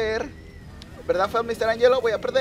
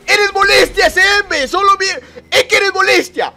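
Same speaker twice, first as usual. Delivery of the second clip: high, loud annoyed yelling; real frustrated yell; exasperated shout; 12 semitones higher, 11 dB louder than usual. Real, not acted. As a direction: shout at the pesky rival.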